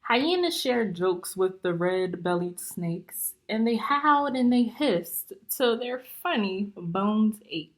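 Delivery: lively, bouncy rhyming storybook reading; story read-aloud. Acted. Teacher reading a rhyming book to young students, lively. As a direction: read it out to the class, full of life.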